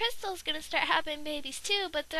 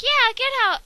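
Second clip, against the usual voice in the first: much louder, higher yell; angry shout; 6 semitones higher, 10 dB louder than usual. Pretend. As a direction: throw him out loudly. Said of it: "Crystal was like".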